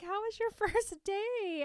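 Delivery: high-pitched eager parent question; lightly acted upbeat father; mock cheery dad. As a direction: imitate a dad asking brightly. Dad greeting daughter in retold scene.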